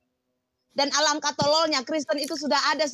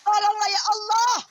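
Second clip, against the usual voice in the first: mock shouting; 6 semitones higher than usual. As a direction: mimic frantic cries to God, hammed-up.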